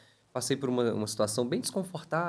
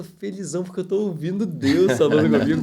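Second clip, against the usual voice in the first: higher and much louder, bursting with joy; overjoyed; 4 semitones higher, 10 dB louder than usual. Retold or imitated. Telling the hosts how happy God's voice made him, overjoyed. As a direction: let the delight spill out. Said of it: "eu ficava assim".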